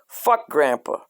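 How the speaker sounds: usual speaking voice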